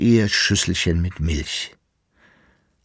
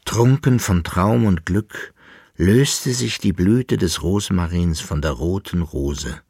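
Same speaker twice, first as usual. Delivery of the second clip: usual speaking voice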